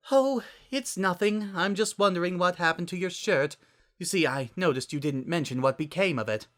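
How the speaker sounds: a lightly voiced drama queen; dramatic pained. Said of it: "with a pained sigh".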